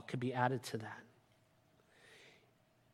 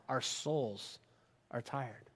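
hushed; 5 semitones lower, 10 dB quieter, slower than usual. Acted. Preacher's hushed build on souls.

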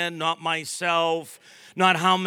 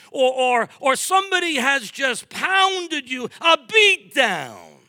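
high, impassioned pulpit delivery; full-force preaching; 7 semitones higher, 4 dB louder than usual. Real, not acted. Preacher to anyone told they're nothing, full-force preaching. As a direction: preach it out with raised pitch and driving emphasis.

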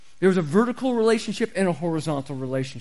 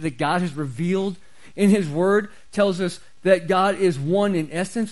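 usual speaking voice

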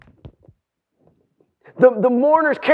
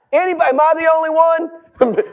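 normal talking voice

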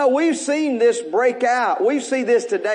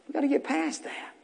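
hushed fade; 3 semitones higher, 10 dB quieter, faster than usual. Shown as a demonstration. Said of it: "repeated softest".

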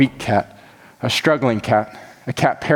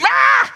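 short mock scream of fright; startled cat scream; 6 semitones higher than usual. Preacher acting the cat startled by trumpets, mock scream. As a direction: yelp as if badly startled. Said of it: "freaking out".

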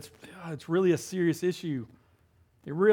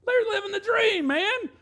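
much higher, gleeful; excited. Real, not acted. Preacher to congregation, gleefully higher. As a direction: sell the paradise with glee. Adam and Eve in paradise.

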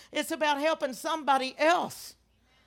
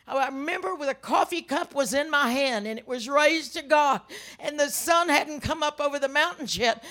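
ordinary delivery

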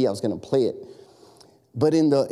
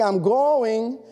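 higher, drawn-out protest with a lift; exasperated preteen; 7 semitones higher than usual. Retold or imitated. Preteen daughter answering her nagging parent, exasperated. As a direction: imitate a preteen putting Dad off. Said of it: "get on my last nerve".

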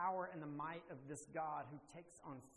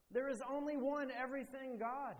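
high, louder proclamation hitting one; preaching emphasis; 8 semitones higher, 6 dB louder than usual. Real, not acted. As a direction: land the final word of the sermon point. Preacher drives home the point.

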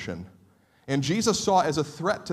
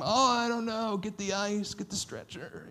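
softer, hammed-up hurt voice fading away; milk the injury for sympathy; mock pained; 6 semitones higher, 6 dB quieter than usual. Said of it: "half milking it".

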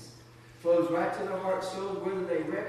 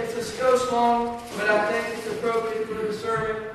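ordinary talk

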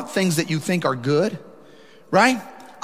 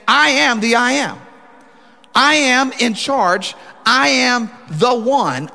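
slow, higher, louder, every I am hammered; emphatic declaration; 7 semitones higher, 4 dB louder, slower than usual. Acted. Preacher voicing Jesus claiming authority, slow and emphatic. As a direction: proclaim it slowly, each phrase a blow.

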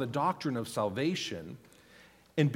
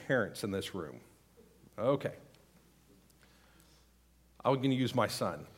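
ordinary delivery

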